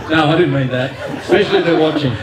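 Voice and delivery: backpedalling through laughter; half-laughing apology to the family watching. Real, not acted. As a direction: laugh as you take the joke back.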